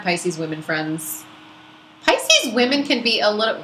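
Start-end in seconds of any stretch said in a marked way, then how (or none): 2.0-3.6 high, rising pitch that gets away from you; voice going up. Real, not acted. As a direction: let the voice jump up high by accident. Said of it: "my voice went up there".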